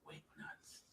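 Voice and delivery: breathy whispered aside. Real, not acted. Whispered echo of a jab.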